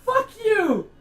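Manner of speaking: high, loud, laughing shock after a jump scare; startled shouting. Real, not acted. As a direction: yell it, still rattled and laughing. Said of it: "You fucking jump scared me".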